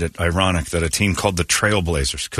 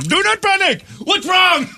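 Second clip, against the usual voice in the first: mock Indian accent. Showing the scariest accent to hear, high mock Indian accent. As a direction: demo the alarming Indian reassurance. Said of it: "scariest accent to hear".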